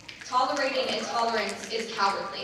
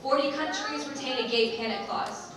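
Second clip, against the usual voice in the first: usual speaking voice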